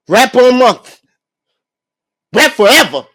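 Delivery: loud, egging-on shout; hyping taunt. Retold or imitated. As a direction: pump up the rapper with a taunt. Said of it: "then after he's like".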